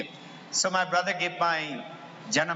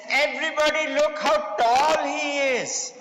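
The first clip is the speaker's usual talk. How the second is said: mocking teacher; 7 semitones higher than usual. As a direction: sneer at the boy in front of everyone. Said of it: "he used to come and mock".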